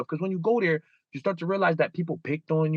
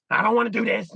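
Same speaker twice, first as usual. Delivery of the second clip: put-on whiny little baby; 6 semitones higher than usual. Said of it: "all like a little baby".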